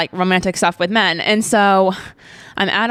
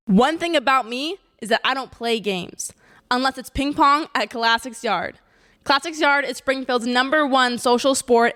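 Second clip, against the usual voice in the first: higher, softer, upbeat sponsor read; cheerful podcast sponsor plug; 4 semitones higher, 4 dB quieter than usual. Acted. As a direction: read it like a friendly ad spot.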